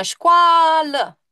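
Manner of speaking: școală called out, A drawn long; calling out. Shown as a demonstration. Teacher shows how you call out the word. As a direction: call școală as if across a yard. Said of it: "Când strigi tu".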